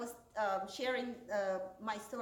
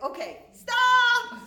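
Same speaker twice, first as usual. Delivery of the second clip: much higher and louder, drawn out slowly; overwhelmed guide cries stop; exasperated shout; 13 semitones higher, 14 dB louder, much slower than usual. Retold or imitated. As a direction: stretch out a desperate halt.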